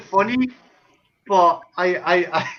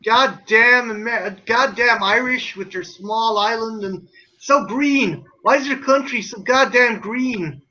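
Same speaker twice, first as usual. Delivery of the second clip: Irish speaker parodying American speech; put-on American accent. Shown as a demonstration. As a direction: play a blustering American abroad. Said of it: "try and do an American accent".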